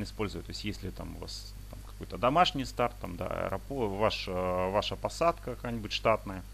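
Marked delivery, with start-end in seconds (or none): none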